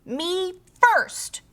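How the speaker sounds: high, squealing pig cry; piggy shout. A narrator's reading. Pig cries at the slide line. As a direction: squeal it out like a greedy piglet.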